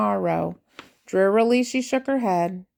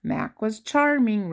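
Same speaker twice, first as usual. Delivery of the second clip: ordinary delivery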